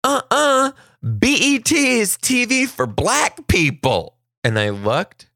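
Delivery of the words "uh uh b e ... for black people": put-on high, loud know-it-all kid; imitating a kid cutting in to correct, pitched high; sassy kid correcting. Kid butting in to correct a classmate, high sassy voice. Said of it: "turns around and he goes".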